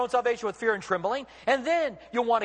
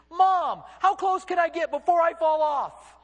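put-on excited kid shouting back, high and loud; playful child's shout from the canyon edge; child calling out; 8 semitones higher, 4 dB louder, slower than usual. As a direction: yell it back like a reckless five-year-old.

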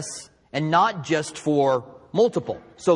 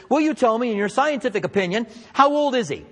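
asking the scientist; 6 semitones higher, faster than usual. Retold or imitated. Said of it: "ask a scientist".

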